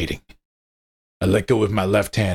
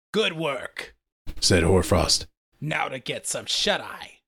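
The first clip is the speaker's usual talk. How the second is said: higher, soft and dismissive; werewolf Alpha voice; 6 semitones higher, 4 dB quieter, slower than usual. Acted. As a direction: pack leader signing off.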